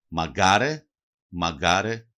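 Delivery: "Μαγκάρε" with the weight on γκά; penultimate stress. Shown as a demonstration. Teacher shows dialect stress on the second-last syllable, "Μαγκάρε" stressed on γκά. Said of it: "τονίζουν συνήθως στην παραλίγουσα".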